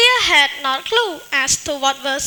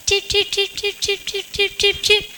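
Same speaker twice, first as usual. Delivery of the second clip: bird chirps; 4 semitones higher, 5 dB quieter than usual. Acted.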